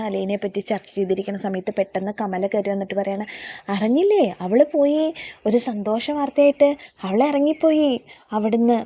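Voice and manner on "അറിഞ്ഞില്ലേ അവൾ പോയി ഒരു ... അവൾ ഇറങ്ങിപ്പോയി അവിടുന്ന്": much higher and louder, crowing over good news; imitated spiteful delight; gleeful announcement. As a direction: announce it like a triumph. Gleeful news of her leaving.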